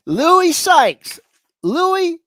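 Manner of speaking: usual speaking voice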